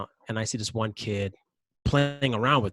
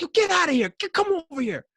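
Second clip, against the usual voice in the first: much higher, louder call; yelling; 5 dB louder than usual. Retold or imitated. Calling the kids out of the pool, high loud shout. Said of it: "I yelled at her".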